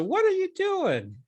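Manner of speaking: very high, slow mock disbelief; acted-out appalled double-take; retold baffled look. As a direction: drag it out in squeaky incredulity. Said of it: "That was the look I gave her".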